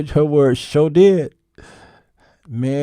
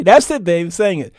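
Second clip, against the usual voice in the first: louder, higher eager question; eager wife; 5 semitones higher, 4 dB louder than usual. Retold or imitated. Wife asking her husband for another song, eager and loud. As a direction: egg him on for more.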